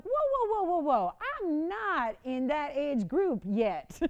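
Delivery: playful protest, drawn-out denial; mock protest. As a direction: wave it off in playful alarm. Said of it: "I'm like".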